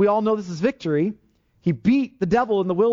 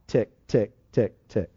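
clock ticking; 8 semitones lower, slower than usual. Shown as a demonstration. Preacher mimicking a clock for the congregation, low ticking.

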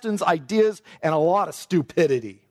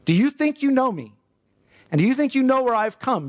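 ironic challenge; 7 semitones higher than usual. Shown as a demonstration. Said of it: "that's the sense of this".

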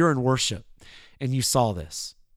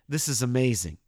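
ordinary talk

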